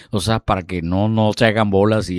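normal talking voice

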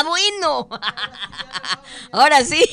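high, loud words between guffaws; laughing. Real, not acted. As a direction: try to talk while cracking up. Habla entre carcajadas. (entre carcajadas, agudo y fuerte)

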